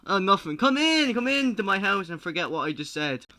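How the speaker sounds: loud fake welcome; loud cover-up. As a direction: bluster over the awkward moment. Judge covering up, loudly.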